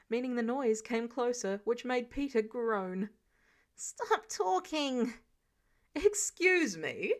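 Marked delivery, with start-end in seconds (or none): 3.7-5.1 pained outburst. Acted. Peter in pain from noise. 5.9-7.1 offended. Acted. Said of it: "Mister Stark sounded offended".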